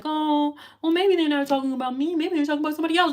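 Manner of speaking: hammed-up innocent denial; mock self-doubter. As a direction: imitate someone dodging the message. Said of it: "You can't be like".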